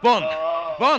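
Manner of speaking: calling out. Acted. Calling for companion after shot.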